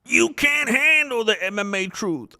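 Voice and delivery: high, stretched-out parody of a dramatic film line; mock movie outburst. Streamer taunting viewers with film parody, mock-dramatic outburst. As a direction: declaim it high, like a hammed-up courtroom climax.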